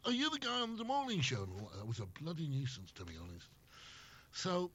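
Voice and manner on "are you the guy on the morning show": high-pitched excited passenger quote; imitating an excited fan, pitched high; eager recognition. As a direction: pipe up like a thrilled listener spotting someone. Fans hailing the cab driver.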